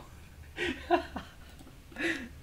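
high breathy giggle that falls; real airy laughter. Real, not acted. Laughs at FOMO remark.

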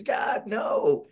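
hammed-up shout of horror; anguished cry. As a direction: cry out in comic despair. Begging not to use the cover.

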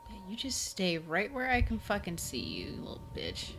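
soft, hissed threat under the breath; taunting whisper. Real, not acted. Player watching the monster, taunting whisper. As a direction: whisper a threat you keep it in sight.

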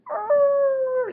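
loud, high playful animal roar. Acted. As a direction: let out a big cartoon roar. Lion roars before elephant.

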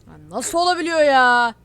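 real gamer rage, shouting; outraged shout. Real, not acted. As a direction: yell at the screen in disbelief. Outraged at being killed.

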